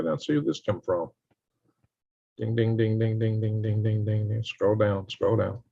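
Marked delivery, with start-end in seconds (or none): 2.4-4.4 mock bell chime. Super chat alert noise.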